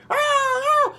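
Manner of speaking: high shrill screech; bird screech. Acted. Bird cry.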